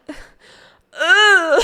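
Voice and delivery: loud, high groan of exasperation; frustrated groan. Real, not acted. Streamer failing to pronounce the phrase, frustrated groan. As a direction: groan as if giving up.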